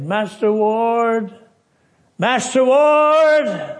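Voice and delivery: high, very slow, loud dying cry; desperate call. Retold or imitated. Dying boy calling Master Ward with his last strength. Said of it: "with all the strength that he had".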